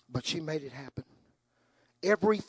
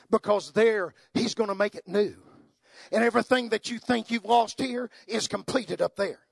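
rising preacher's voice, louder and higher; preaching; 6 semitones higher, 6 dB louder than usual. Acted. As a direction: lift it toward heaven with fervour.

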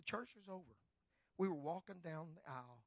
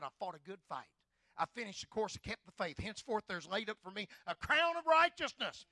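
louder, rising proclamation; preaching; 5 semitones higher, 12 dB louder than usual. Acted. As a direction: build to the crown. Preaching Paul's last words.

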